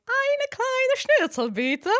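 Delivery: high, slow hammed-up German order; tourist German order. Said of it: "All the time I'm like".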